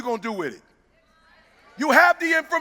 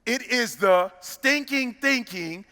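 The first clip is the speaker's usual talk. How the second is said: drawn-out words, spaced for emphasis; half-speed, weighty delivery of each word; slow, deliberate restatement; 3 semitones lower, 3 dB quieter, much slower than usual. Shown as a demonstration. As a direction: say it very slowly so they catch it. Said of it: "I'm gonna say it again".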